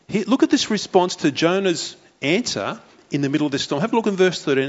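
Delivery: ordinary talk, nothing marked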